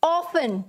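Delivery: often said as OFF-un, no T sound; often pronounced without its T; often with silent T. Shown as a demonstration. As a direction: say often like "awfun", the T left silent. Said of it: "pronunciation के लिए कैसे".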